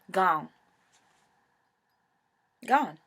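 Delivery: loud, falling emphatic gone; loud despairing emphasis. Real, not acted. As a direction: hit each word hard and loud. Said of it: "money missing".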